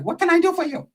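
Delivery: high put-on accent; Shorty's accent. Shorty offering help in a high accent. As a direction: ask like the gang boss. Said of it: "he's like".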